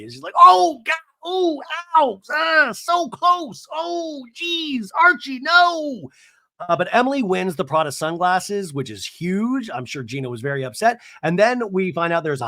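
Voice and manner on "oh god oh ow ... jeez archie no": high, drawn-out anguished wailing; exaggerated loud groaning over a near miss; mock dismay. Imitating Shannon missing the putt, mock dismay. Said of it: "her body moves like Jim Carrey".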